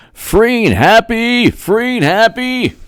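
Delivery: high drawn-out sing-song call; sing-song greeting to a viewer. Acted. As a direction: stretch the name out high and slow like a chant. Sings out a viewer's name.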